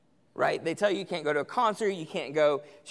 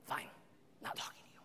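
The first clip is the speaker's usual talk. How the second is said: sulky mutter; 18 dB quieter, faster than usual. Retold or imitated. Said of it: "until finally you go upstairs".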